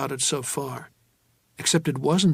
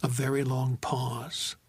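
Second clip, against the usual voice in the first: plain reading voice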